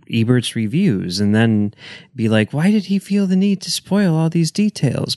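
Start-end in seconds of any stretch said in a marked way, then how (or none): 2.5-5.1 high-pitched, incredulous complaint; mock exasperation. Reader grumbling at a spoiler-filled review, mock exasperation. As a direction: protest it in a raised pitch, half joking.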